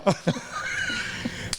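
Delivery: high unvoiced cackle; shrieking laugh. Real, not acted. Big laugh.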